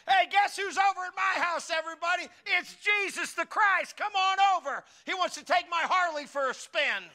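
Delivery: high, loud giddy broadcast; excited announcement. Pretend. Preacher imagining posting that Jesus visited, loud and giddy. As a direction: shout it to all your friends online. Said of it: "I might end up getting on Facebook".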